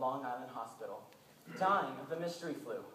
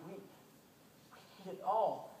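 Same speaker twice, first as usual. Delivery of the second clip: grave slow admission; 2 semitones higher, much slower than usual. Retold or imitated. Doctor admits grave truth.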